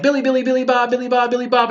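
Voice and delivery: high-pitched singsong mocking chant; taunting kid. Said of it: "and the kid's like".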